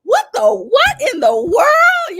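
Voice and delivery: high, slow, laughing disbelief; high slow laughing what in the world; stunned disbelief. Retold or imitated. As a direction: cry out in amazed disbelief. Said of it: "And I'm like".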